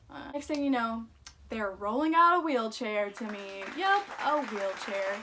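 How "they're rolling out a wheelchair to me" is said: ordinary talk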